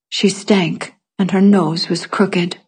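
plain reading voice

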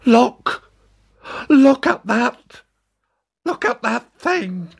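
high, shrieking cry of terror; terrified shout. Acted. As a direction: scream it out in horror. Passenger sees the monster.

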